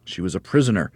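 ordinary delivery

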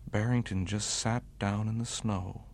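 ordinary delivery, nothing marked

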